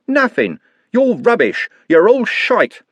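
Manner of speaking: high angry ranting; raging insults. A narrator's reading. Trev raging at the gang, ranting high. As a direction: spit the insults out in a rage.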